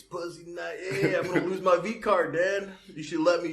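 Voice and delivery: put-on whiny teenage pleading, up high; pushy teen begging dad. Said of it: "I was like, "Yo, Dad".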